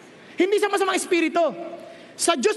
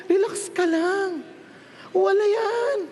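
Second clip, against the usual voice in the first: normal talking voice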